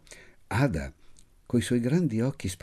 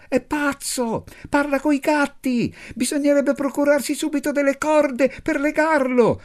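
a little brat crying out in alarm; shouting little girl; 4 dB louder than usual. A narrator's reading. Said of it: "Anna gridò". (bambina che strilla con voce acutissima)

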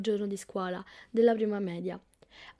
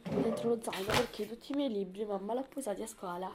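usual speaking voice

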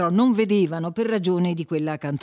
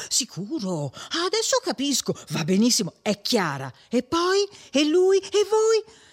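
feigned keen interest; 7 semitones higher than usual. A narrator's reading. Agnese humours the chattering Perpetua, showing feigned interest. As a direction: play the avid listener, building in pitch. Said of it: "per far vedere che stava attenta".

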